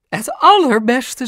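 high, loud, drawn-out superlative; mother gushing at full pitch. A narrator's reading. Mam gushes in agreement. (hoog en uitbundig)

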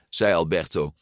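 ordinary delivery

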